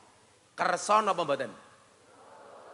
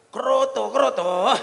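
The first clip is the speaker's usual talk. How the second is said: excited calling; 7 semitones higher, 10 dB louder, slower than usual. Pretend. Husband calling about bird feed.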